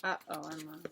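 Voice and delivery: low, hushed uh-oh; soft aside. Real, not acted. Mom softly sharing a worried uh-oh with Emma. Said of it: "aside to child".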